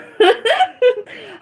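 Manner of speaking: a high laugh bursting out; high burst of laughter. Real, not acted. Laughing at her own coffee joke, high burst of laughter.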